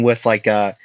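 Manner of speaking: ordinary delivery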